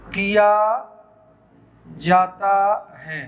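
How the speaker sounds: slow, word-by-word dictation with long pauses. Shown as a demonstration. Teacher dictating a grammar rule to students, slow and deliberate. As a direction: spell it out slowly so everyone can write.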